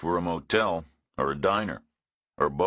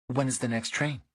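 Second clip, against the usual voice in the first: a dry, wary woman, a touch higher; woman's dry voice; 7 semitones higher than usual. A narrator's reading. Woman complains to Reacher about no signal, dry and higher.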